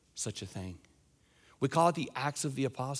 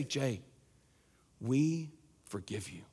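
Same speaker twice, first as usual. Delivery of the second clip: softer, slower, gently reassuring; tender forgiveness; 3 semitones lower, 4 dB quieter, slower than usual. Retold or imitated. Friends forgiving Jay through tears, soft and slow. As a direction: forgive him kindly, taking your time.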